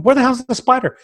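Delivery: high, loud frantic question; desperate to find the spider now; frantic demand. Retold or imitated. Husband frantically demanding where the spider is, loud.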